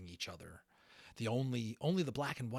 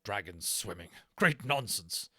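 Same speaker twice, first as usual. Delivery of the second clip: mock gruff; 7 dB louder than usual. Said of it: "belying his words".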